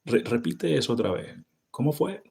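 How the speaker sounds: rising, very high "¿Cómo fue?"; Dominican casual. Shown as a demonstration. Asking a friend to repeat, lively Dominican Spanish. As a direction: ask it back with a lively lift. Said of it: "We say, like".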